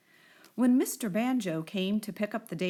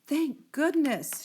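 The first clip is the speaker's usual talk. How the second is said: slow, louder, higher relieved gasp; Ella relieved the cake didn't slide away; gasped relief; 6 semitones higher, 3 dB louder, slower than usual. A narrator's reading. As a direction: breathe it out in relief. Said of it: "she gasped with relief".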